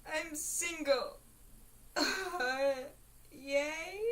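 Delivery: imitating Crystal cheering again; slow giddy cheer. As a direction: drag it out, giggling. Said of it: "she's like".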